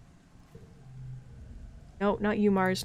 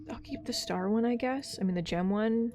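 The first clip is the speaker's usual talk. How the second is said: whisper; 7 dB quieter than usual. Real, not acted. Choosing quietly.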